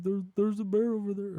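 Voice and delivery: high, loud panicked pointing-out; alarmed. Retold or imitated. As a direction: sound the alarm, voice jumping up. Panicked campers spotting the bear.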